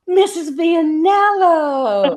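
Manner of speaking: loud, high, drawn-out greeting; gasp, then sing out the name in mock delight; hammed-up thrilled nurse; delighted recognition. Nurse recognizes his old teacher.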